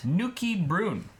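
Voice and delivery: slow, high mock Scottish "Nookie Broon"; hammed-up Scottish accent. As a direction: roll out "Nookie Broon" like a Geordie pub regular. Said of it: "I wish I could do a good Scottish accent".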